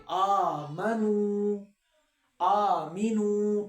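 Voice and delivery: آمنو with the long u held one alif; stretched vowel. Shown as a demonstration. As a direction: draw out the vowel in آمنو slowly. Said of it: "ایک الف جتنا کھینچیں گے".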